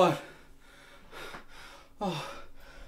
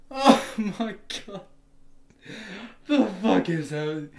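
ordinary talk, nothing marked

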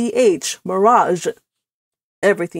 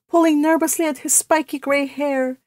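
plain reading voice

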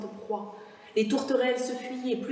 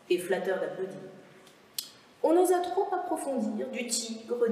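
usual speaking voice

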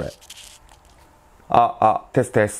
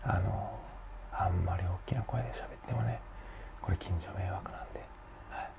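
hushed, near-whisper mic test; barely-there quiet voice; small voice; 17 dB quieter than usual. Shown as a demonstration. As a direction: keep it very soft so the neighbours aren't disturbed. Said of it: "ちっちゃい声から".

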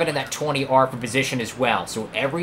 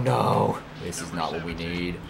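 low, quiet, sinking dismay; 8 semitones lower, 5 dB quieter than usual. Real, not acted. Player seeing the rival threaten his points, dejected. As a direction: let the voice drop, deflated.

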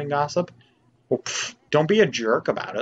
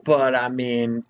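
normal talking voice

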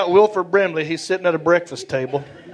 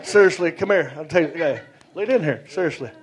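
David Sanders; 6 dB quieter than usual. Retold or imitated. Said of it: "he says this a lot".

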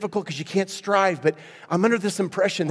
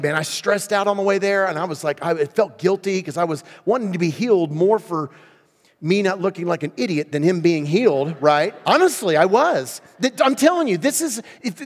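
ordinary talk, nothing marked

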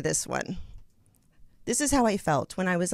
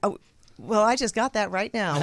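ordinary talk

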